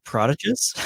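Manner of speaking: "Prodigious" stressed on the first syllable, PROD; mispronounced word. Real, not acted. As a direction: question it as "PROD-i-juz". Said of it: "That's how it's pronounced".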